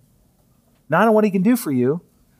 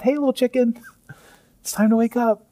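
high, sing-song wake-up call; waking a daughter; 8 semitones higher than usual. Retold or imitated. Father gently waking his little daughter, sing-song. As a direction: coax a sleepy child awake, lightly. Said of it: "what I do with my daughter".

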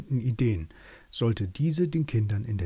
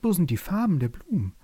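a high, plaintive butterfly lament; lamenting butterflies; 7 semitones higher than usual. A narrator's reading. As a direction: wail it like fluttering butterflies. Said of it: "klagten die Schmetterlinge".

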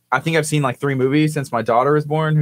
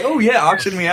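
mock eagerness; 6 semitones higher than usual. Imitating Kurt jumping at the auction.

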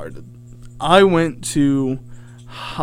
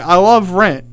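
imitating a friend's yell, louder; a contained mock shout; retold yell; 3 semitones higher, 7 dB louder, slower than usual. Friend yells out to a street singer, mock yell. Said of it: "he yells out".

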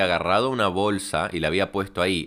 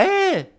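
very high, loud squawked call; shrieked "eh!"; 5 dB louder than usual. Retold or imitated. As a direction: screech it far above your own voice, imitating her. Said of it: "Con este tono". (grito agudísimo imitado)

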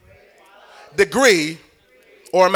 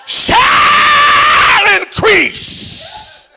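shall increase stretched very slowly, much higher and louder; drawn out slowly; 3 dB louder, much slower than usual. Shown as a demonstration. Preacher demonstrating to the congregation, very slowly drawn out. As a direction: pull out each syllable of "shall increase" as a high, slow call. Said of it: "start at one word... and slow down".

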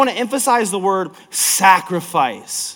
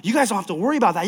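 normal talking voice

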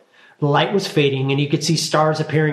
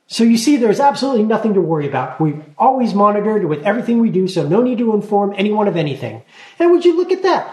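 fast, higher and louder; hasty deflection; 6 semitones higher, 4 dB louder, faster than usual. A narrator's reading. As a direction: rush to change the subject. Talia changing the subject.

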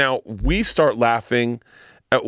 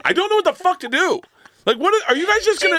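much higher, mock-horrified voice; mock Leah Remini. Retelling the costar stuck watching dark jokes, high mock dismay. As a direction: squeak out her disbelief. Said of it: "Leah Remini is just sitting there going".

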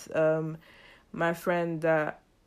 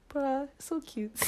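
charmed; 9 semitones higher, slower than usual. Retold or imitated. Girl's sweet answer about Jesus.

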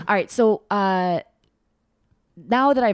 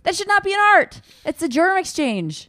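high, loud outraged protest; indignant; 13 semitones higher, 4 dB louder than usual. Real, not acted. Indignant joke about kissing.

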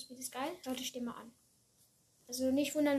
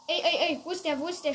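loud, higher frantic searching; panicking gamer; 3 semitones higher, 8 dB louder than usual. Real, not acted. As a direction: panic hunting for the car. Lost the robber in game.

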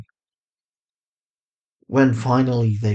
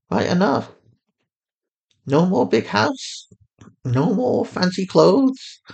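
higher mother voice, calm and steady; Fatima, soothing mother; 9 semitones higher than usual. A narrator's reading. A mother on the phone calmly reassuring her lost daughter.

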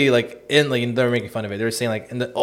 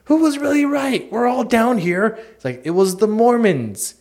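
much higher, slow cartoonish wail; cartoon voices; 10 semitones higher, slower than usual. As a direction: whine it like a cartoon soul in hell. South Park hell scene.

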